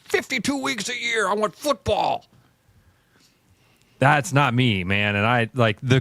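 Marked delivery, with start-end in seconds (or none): 0.0-2.2 higher, mock-eager fan voice; football-crazed fan. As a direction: ham up the guy who wants football all year. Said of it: "guys out there that are like".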